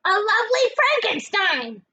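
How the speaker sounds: high, loud, sneering aunt; high mocking hag voice; Aunt Spiker's voice. A narrator's reading. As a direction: jab at her sister in a high voice. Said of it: "Aunt Spiker said".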